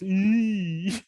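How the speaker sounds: high drawn-out "eee"; cringing squeal. Retold or imitated. Showing friends how awkward watching makes you, cringing squeal. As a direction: squeal like you're cringing for someone.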